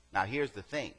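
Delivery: normal talking voice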